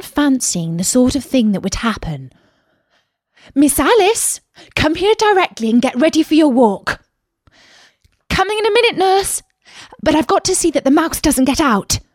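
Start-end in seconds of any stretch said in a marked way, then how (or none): 3.5-7.0 bossy nurse. A narrator's reading. Alice imagines her nurse calling. 8.3-12.0 put-on much higher, little girl reply; child answering. A narrator's reading. Alice answers imagined nurse.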